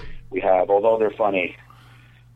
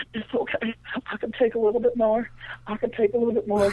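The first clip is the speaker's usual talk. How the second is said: very high, small put-on girl voice; tearful girl; 11 semitones higher than usual. Tearful girl reassuring her partner, high mock-brave voice. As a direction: imitate a tiny girl bravely holding back tears. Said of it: "she had tears coming out of her eyes".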